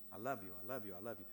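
low, soft, mechanical repetition; rote professions. Acted. Believer telling God with the mouth only, low rote repetition. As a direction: reel it off quietly as empty lip service. Mimics empty professions of love.

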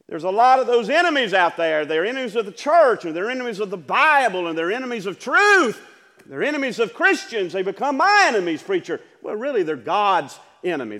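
loud, driving preacher cadence; full-force preaching. Acted. Preacher to his congregation, loud and driving. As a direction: hammer each line home to the congregation.

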